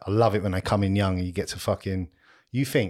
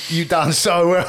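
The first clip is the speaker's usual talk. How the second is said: put-on higher, slow, gushing drunk praise; mock proud drunk; 7 semitones higher, 4 dB louder, slower than usual. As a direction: ham up a pissed stranger's pride. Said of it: "coming up to you and go".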